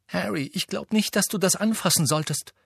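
a clipped sharp warning to Harry. A narrator's reading. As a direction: cut him off sharply. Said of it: "sagte Hermine scharf".

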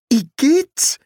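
high, loud cry of disgust. A narrator's reading. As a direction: recoil with a high-pitched yuck. Disgusted reaction in dialogue.

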